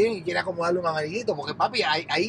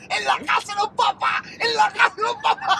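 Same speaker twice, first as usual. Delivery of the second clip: high, laughing near-yell; laughing shout; 12 semitones higher than usual. Real, not acted. Host teases his friend about the parents' house, laughing shout. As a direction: cackle the words out through laughter.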